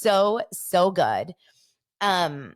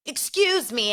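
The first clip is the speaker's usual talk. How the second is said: higher-pitched put-on British accent; lightly hammed-up British accent; mock British accent; 7 semitones higher, slower than usual. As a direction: imitate an indignant British diner. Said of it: "like, a British accent".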